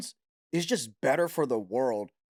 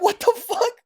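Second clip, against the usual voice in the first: much higher, cracked mock cry; shrill dismay; 7 dB louder than usual. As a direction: squeal in wounded disbelief. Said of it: "I'm like".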